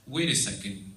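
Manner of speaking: ordinary talk, nothing marked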